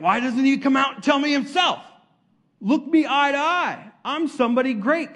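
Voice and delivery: high, indignant mock rant; angry Naaman. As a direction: act out an offended big shot demanding respect. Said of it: "Naaman gets angry".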